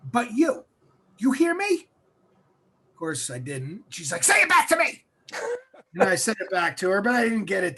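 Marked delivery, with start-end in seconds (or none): 0.1-1.9 raised, high-pitched imitation of mom; stern mother. Mother lecturing her son on his limits, stern imitation. 4.2-5.0 high, loud mock yell; demanding mother. Mother ordering her son to repeat it, mock shouting.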